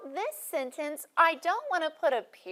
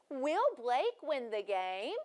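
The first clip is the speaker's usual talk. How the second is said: slow, rising tune on "game"; question rise; slower than usual. Shown as a demonstration. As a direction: model the question, lift the end. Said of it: "She made her voice go up".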